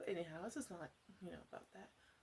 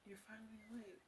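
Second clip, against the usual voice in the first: normal talking voice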